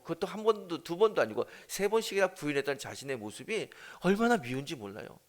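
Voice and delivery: usual speaking voice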